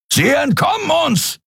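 high, shouted alarm; frustrated shout. A narrator's reading. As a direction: yell as the prey gets away. Captain shouting at fleeing ship.

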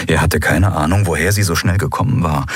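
ordinary delivery, nothing marked

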